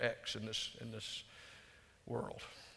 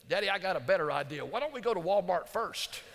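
rising into a high, louder pitch; eager child; 6 semitones higher, 5 dB louder than usual. Retold or imitated. Four-year-old bargaining with daddy outside the hospital, eagerly. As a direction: imitate a little kid's excited brainwave. Daughter pitches her bright idea.

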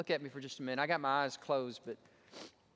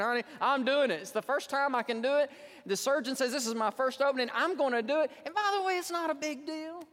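high, insistent protest; stubborn husband; 10 semitones higher, 4 dB louder than usual. Retold or imitated. Husband insisting to wife on surgery, mock-stubborn protest. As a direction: argue back loudly, climbing in pitch. Acts out his stubborn reply.

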